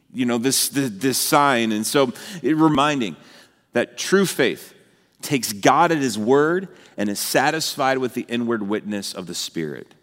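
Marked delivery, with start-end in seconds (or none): none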